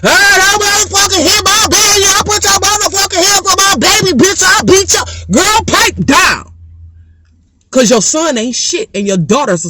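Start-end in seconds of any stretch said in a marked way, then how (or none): 0.0-5.0 hollering mama. Pretend. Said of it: "hootin' hollering ass mama". 5.3-6.5 put-on slow high shout of girl, pipe down; shouting back. Shouting down the mama.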